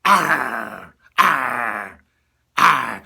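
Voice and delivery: loud growling pirate roar; pirate bad guy. Shown as a demonstration. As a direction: growl from the throat with lots of air. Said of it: "sometimes use the pirate".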